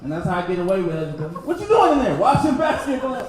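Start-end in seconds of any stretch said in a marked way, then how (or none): 1.5-2.1 higher, louder mock call; wife calling out. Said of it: "wife calling from another room". 2.2-3.2 louder, higher covering reply; calling back innocently. Retold or imitated. Answering wife's call.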